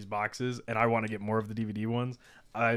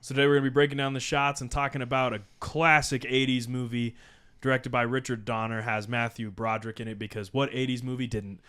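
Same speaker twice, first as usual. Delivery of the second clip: podcast host intro; 5 dB louder than usual. Acted.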